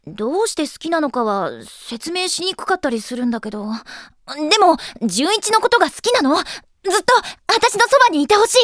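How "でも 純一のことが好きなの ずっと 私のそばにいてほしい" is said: loud, higher, urgent plea; impassioned confession. Acted. Girl wanting him by her side, impassioned. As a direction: let the love burst out louder and higher. Repeats confession with full feeling.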